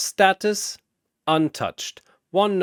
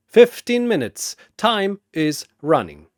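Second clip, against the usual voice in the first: much higher, louder proclamation; declaring the timer; 5 semitones higher, 6 dB louder than usual. Acted. Chen flipping the hourglass for Shazam, proclaiming. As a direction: announce the countdown with relish.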